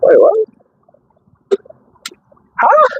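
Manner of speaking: sharp high startled "wait, what?"; startled disbelief. Real, not acted. Streamer realizing he was muted, shooting up in surprise. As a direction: caught off guard, voice shooting up. Sudden shock at the screen.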